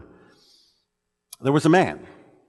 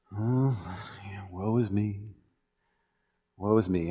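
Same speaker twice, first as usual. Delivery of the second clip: softer, lower mock woe is me; mopey self-pity; 4 semitones lower, 11 dB quieter than usual. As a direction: sigh it out like a sulking kid. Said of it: "that attitude that says".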